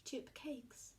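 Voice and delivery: ordinary delivery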